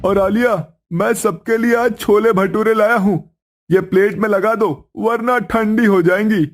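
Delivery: husband calling his wife to serve the food; husband's voice. Acted. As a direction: play the cheerful husband bringing food home.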